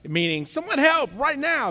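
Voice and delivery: high, urgent call for help; drowning cry. Acted. Drowning person calling for rescue, urgent cry. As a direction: call out as if going under. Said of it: "call out if you were drowning".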